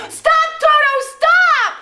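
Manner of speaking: very high, loud yelling while chasing; frantic shout. Retold or imitated. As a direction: scream at the dog to stop mid-chase. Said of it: "following this dog going".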